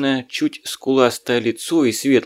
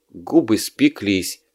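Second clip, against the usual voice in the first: usual speaking voice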